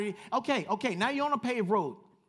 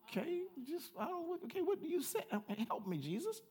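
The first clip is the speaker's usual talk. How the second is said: high, hushed, hesitant mock prayer; unsure churchgoer; 9 semitones higher, 6 dB quieter than usual. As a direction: mimic a lost believer mumbling for help. Mocking an unsure churchgoer praying.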